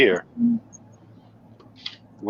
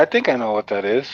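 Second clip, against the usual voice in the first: usual speaking voice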